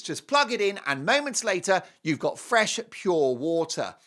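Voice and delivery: usual speaking voice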